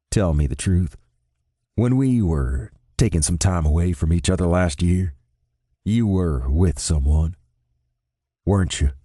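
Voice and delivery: lightly voiced Noel, steady and searching; Noel, probing. A narrator's reading. Noel asking Ben about last year: low and level.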